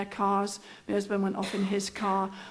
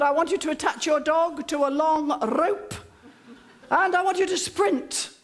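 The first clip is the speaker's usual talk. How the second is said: higher-pitched, hammed-up instructor; bossy trainer instructions; 5 semitones higher than usual. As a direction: rattle off orders like a drill instructor. Trainer gives long instructions.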